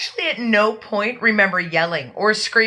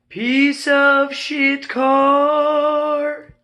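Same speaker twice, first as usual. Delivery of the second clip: slow, drawn-out higher sneer at the car; sarcastic jab; 5 semitones higher, much slower than usual. Real, not acted. Sister mocking the old car, a slow sarcastic sneer. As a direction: drag it out with scorn. Sister mocks the turquoise Neon.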